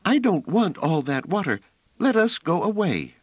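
put-on high, choked, frightened girl's plea; pleading to escape, voice catching; half-choked child. A narrator's reading. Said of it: "in a half-choked manner".

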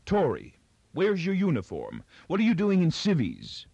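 high, astonished questions; wide-eyed surprise. A narrator's reading. Wife confronting husband out of uniform, astonished. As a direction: pitch climbing with each startled question. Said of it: "she was circling him wide-eyed".

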